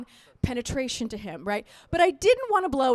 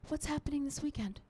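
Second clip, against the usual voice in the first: near-whispered casual text; hushed, breathy aside; innocent texting; 2 semitones higher, 13 dB quieter than usual. Retold or imitated. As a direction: read it out very softly as if typing. Mimes typing an innocent text.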